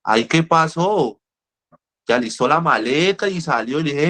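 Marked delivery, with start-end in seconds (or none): 0.0-1.2 slow, high, stretched-out disbelief; mock astonishment. Counselor reacting to patient's sudden recovery, drawn-out mock surprise. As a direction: draw out each word higher, acting amazed.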